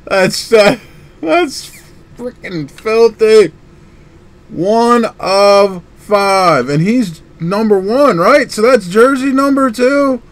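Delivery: loud, high cheering, with drawn-out praise; ecstatic whoops. Real, not acted. Collector celebrating his pull with viewers, overjoyed. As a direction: cheer like you just won it all.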